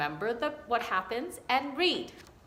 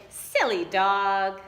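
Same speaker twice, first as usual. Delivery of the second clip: slow, fond owner's voice, a bit louder; Vicky's voice; 7 dB louder, much slower than usual. Shown as a demonstration. Teacher showing the right voice for Vicky, slow and fond. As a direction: say it like a loving owner teasing her pet. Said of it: "Use a voice that sounds like what Vicky would sound like".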